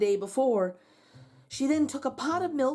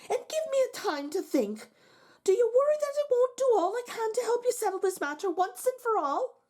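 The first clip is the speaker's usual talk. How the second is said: higher, lilting wife's voice; 12 semitones higher than usual. Acted. Wife urging her husband to face the giant, higher pitched. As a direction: coax him to stand and face it.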